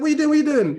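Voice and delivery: high, loud, panicky questioning; alarmed protest. Retold or imitated. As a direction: cry out in alarm as the platform rises. Retelling his panic on the platform.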